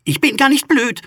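high, loud indignant protest; offended. A narrator's reading. Skull talks back to the ghost who insulted it, loudly offended. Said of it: "sagte der Schädel beleidigt".

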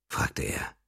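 soft, breathy narrator whisper. A narrator's reading. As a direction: breathe the words out, no voice. (geflüsterte Erzählstimme)

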